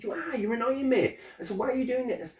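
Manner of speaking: loud and high, heated retort; angry retort. Retold or imitated. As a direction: snap back at him, voice way up. Retold argument with anger counsellor.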